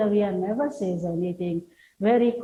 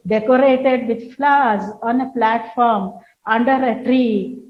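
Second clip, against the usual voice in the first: stage direction read aloud; 2 semitones higher, 5 dB louder than usual. Shown as a demonstration. Teacher reads the play's stage direction to students, loud and measured. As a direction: announce the scene setting to the class.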